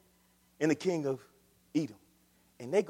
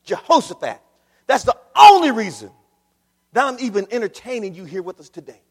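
prophet's rebuke; 4 semitones higher, 10 dB louder than usual. Acted. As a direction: bark the reason at the king. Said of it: "But he says".

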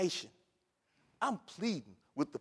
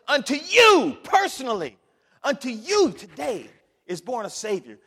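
loud, high announcement; proclaiming angel; 7 semitones higher, 11 dB louder than usual. Acted. Angel loudly announcing the birth to the shepherds. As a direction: herald it to the shepherds. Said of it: "the angel says".

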